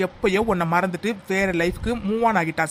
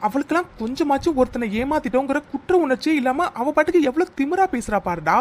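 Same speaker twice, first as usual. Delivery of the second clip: higher, heated rant to a friend; indignant outburst; 6 semitones higher than usual. Acted. Telling his friend how arrogantly she talks, heated and raised. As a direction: vent about her arrogance.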